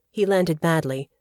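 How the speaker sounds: usual speaking voice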